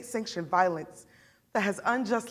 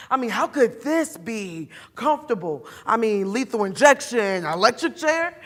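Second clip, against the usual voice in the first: louder, rising disbelief; indignant and incredulous; incredulous scoffing; 3 semitones higher, 7 dB louder than usual. Acted. Mother scoffs at being made comfortable.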